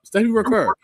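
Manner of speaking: loud, high-pitched mangling of "Stephanie Vaquero"; mock mispronounced name. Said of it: "she messed her name up".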